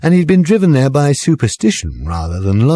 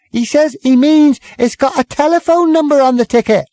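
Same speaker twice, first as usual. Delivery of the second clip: barmaid calling out. A narrator's reading. Barmaid calling across the noisy bar to the landlord. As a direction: call over the bar noise to Jim.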